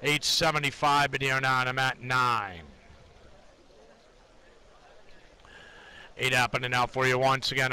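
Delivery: low, steady rhythmic price calls; trading floor price calls. Acted. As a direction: call the bids in a monotone chant. Live price ladder callouts.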